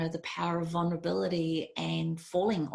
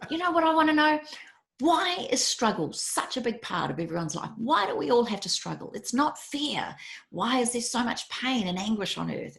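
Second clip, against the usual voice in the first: raised, higher and louder, pleading why; exasperated plea; 7 semitones higher, 4 dB louder than usual. Retold or imitated. Pleading with the monk why life hurts, raised and insistent. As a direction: demand answers from life, voice lifted high. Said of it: "And I said".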